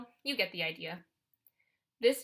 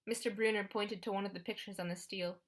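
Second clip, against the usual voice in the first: usual speaking voice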